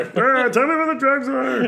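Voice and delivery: high, loud whiny voice; Ray Romano impression. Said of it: "he did Ray Romano".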